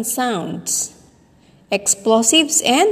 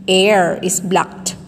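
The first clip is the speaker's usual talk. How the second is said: ordinary talk, nothing marked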